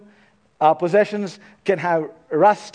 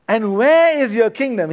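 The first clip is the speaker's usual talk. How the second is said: loud, higher mock-skeptical "where is your kingdom"; sarcastic question; 6 semitones higher, 5 dB louder than usual. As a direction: challenge him with a sneering question. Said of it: "in a little bit of sarcasm".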